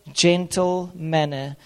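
ordinary talk, nothing marked

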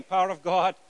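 ordinary talk, nothing marked

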